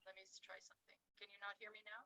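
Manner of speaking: faint, distant audio; speaking from far off the microphone; far from mic. Real, not acted. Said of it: "You sound like you're far away".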